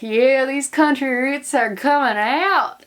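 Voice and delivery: playful drawled country twang. Retold or imitated. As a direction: lean into a Southern twang. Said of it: "And I was like".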